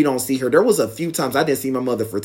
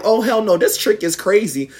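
shocked disbelief; 7 semitones higher than usual. Retold or imitated. Reacting to mother storming after.

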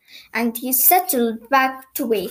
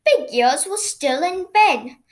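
ordinary delivery

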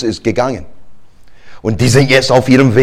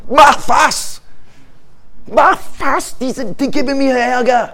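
much higher, whiny shout; desperate demand; 11 semitones higher than usual. Acted. Moses demanding God act, high whiny shout. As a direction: beg God to act now.

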